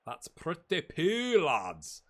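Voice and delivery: "That's pretty poo" stretched slowly, "poo" long and falling; slow, sing-song "That's pretty poo"; drawn-out poo. Shown as a demonstration. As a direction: savour the word "poo", slowly.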